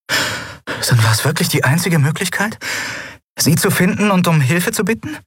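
a cheeky captain teasing the eidolon; young woman Endriel. Acted. Endriel proposes teasingly to Yunnan, playful high voice.

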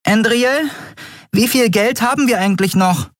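very high, dainty character voice; high, tender little voice; delicate voice. A narrator's reading. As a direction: ask in a small, delicate, high-pitched voice. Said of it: "wobei ihre zarte Stimme fast im Lärm unterging".

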